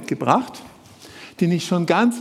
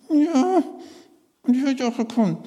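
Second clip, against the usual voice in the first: higher, sulky puppet voice; puppet Willi; 7 semitones higher than usual. Acted. Puppet Willi complaining to the presenter about his gift, sulky. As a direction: mope like a disappointed bird.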